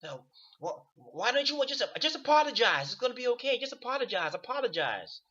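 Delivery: high, sing-song wheedling voice; mock coaxing voice. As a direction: coax someone like you're humouring a child. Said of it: "They, they like".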